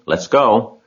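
rally them to go; calling out. A narrator's reading. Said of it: "cried Mister Zuckerman".

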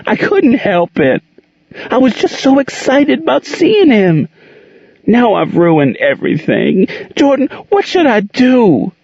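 high, pleading and tearful; begging for help through tears; plaintive. A narrator's reading. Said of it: "Monique asked plaintively".

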